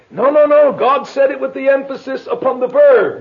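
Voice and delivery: loud, high-pitched mock objection; acted quarrel over exact wording; arguing. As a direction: cut in insistently like someone in a heated debate. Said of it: "We'd sit here arguing about precisely what was said".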